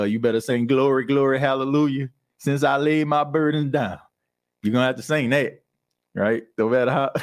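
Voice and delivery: normal talking voice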